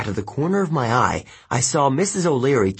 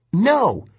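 high, raised-voice refusal; defiant cry; 10 semitones higher, 4 dB louder than usual. A narrator's reading. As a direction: cry out against the villain, pitch up. Hero defies the ghost.

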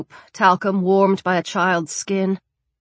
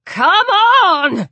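high, loud urgent yell; frantic drawn-out shout; bawled; 12 semitones higher, 7 dB louder than usual. Acted. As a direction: bawl it out, stretched long. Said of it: "Sharon bawled".

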